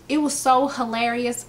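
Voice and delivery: ordinary delivery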